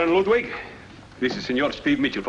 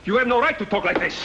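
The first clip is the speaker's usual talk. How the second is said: higher and hotter, leaning on right; angry repeat; 6 semitones higher than usual. Acted. Colonel repeating his objection to Barrero, angrier. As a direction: throw the accusation back at him. Heated quarrel over the letter.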